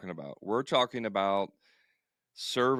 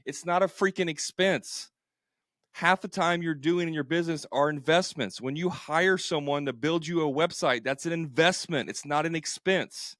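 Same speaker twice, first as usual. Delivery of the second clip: raised, heated rant; fired up; 7 semitones higher, 3 dB louder than usual. Real, not acted. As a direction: get worked up and push it. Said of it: "I get fired up".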